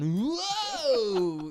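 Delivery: high loud startled shout; startled whoa. Pretend. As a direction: jump back with a shout.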